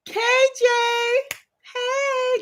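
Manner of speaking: very high, singsong call; calling out greeting. Real, not acted. Greeting a grad schooler who joined, high singsong. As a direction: sing out a drawn-out hello.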